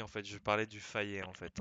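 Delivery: ordinary talk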